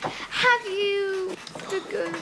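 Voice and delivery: hesitant translation. Acted. Pupil translates for teacher, halting and unsure. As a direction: grope for the English word.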